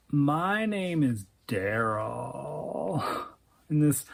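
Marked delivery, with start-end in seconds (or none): 0.1-3.0 slow, soft, whiny stoner drawl. Acted. Stoner Daryl introducing himself to Celia, whiny slow drawl. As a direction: stretch it out like a stoned slacker. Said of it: "whiny stoner dude drawl".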